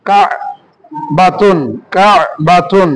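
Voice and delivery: slow কা বাতুন, কা pressed from the throat; slow throat-pressed demo. Shown as a demonstration. Teacher slowly models the throat sound for students. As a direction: spell out কা বাতুন slowly for the class. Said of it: "যাতে কণ্ঠনালীকে চাপ দিয়ে পড়তে হয়".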